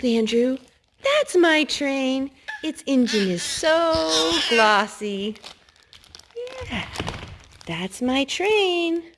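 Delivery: put-on drawn-out bright read for a toddler; excited picture book voice; reading to children.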